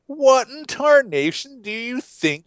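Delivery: loud, slow folksy outrage; mock-outraged what-in-tarnation; exasperated Peter. Peter scolding Jesus at the foot washing, hammed-up outrage. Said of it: "he's saying".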